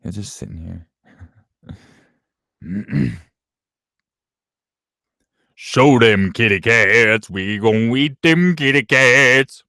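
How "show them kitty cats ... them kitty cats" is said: loud, high, drawn-out goofy voice; hammed-up hungry chant, climbing higher. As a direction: holler it slowly like a cartoon yokel. Putting on a silly cat-eater voice.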